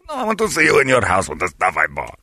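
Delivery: ordinary talk, nothing marked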